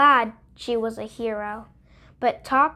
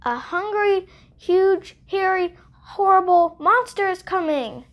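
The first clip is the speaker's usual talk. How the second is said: frightened fox; 8 semitones higher, 3 dB louder than usual. Acted. Fox panics after yelping.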